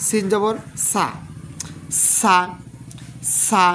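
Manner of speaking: drawn-out সা with a hissing whistle on the s; whistled s sound. Shown as a demonstration. Quran teacher showing students the whistle, slow hissing সা. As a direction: say সা slowly, letting the s whistle. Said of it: "দেখেন শীষ আসতেছে কিন্তু".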